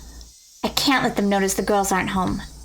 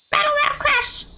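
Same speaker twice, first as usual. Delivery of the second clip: very high, squealed crash noise; squeaky sound effect. Acted. Narrator voicing buckets crashing down, in a very high squeal. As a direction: squeal it out like a cartoon clatter.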